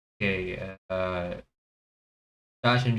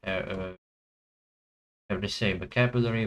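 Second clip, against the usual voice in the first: ordinary talk, nothing marked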